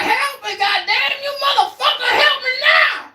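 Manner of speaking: high, loud, cursing demand for help; hammed-up screaming old man; furious shout.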